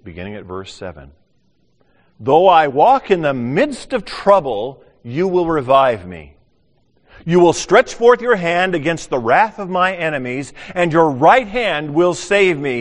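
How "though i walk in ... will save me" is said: loud, slow proclaiming, higher; forceful scripture reading. Acted. Preacher reading Psalm 138 aloud, ringing and slow.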